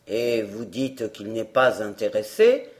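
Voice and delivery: gruff showman. A narrator's reading. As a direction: growl it like a hoarse showman.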